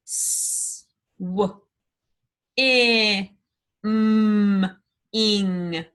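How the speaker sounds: S, w, i, m, ing said sound by sound; segmented sounds. Shown as a demonstration. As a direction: sound out each part apart. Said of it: "blend these words".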